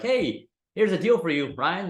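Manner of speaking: high, slow, sing-song offer; a hammed-up cheerful hello; mock cheerful deal. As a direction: play the deal calling out to Ryan.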